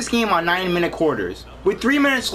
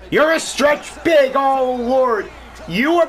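slow, loud, higher celebration; excited shout; 6 semitones higher, 3 dB louder, slower than usual. Real, not acted. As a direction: yell it as the shot drops. Celebrating the shot.

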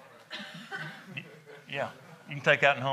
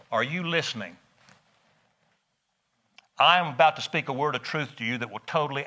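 ordinary talk

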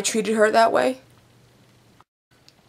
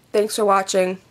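normal talking voice

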